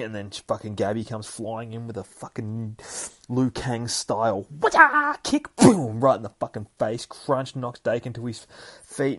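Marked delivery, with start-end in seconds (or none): 3.3-7.1 excited fight narration. Retold or imitated. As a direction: belt out the kick like a fight cry. Narrating kung fu kick.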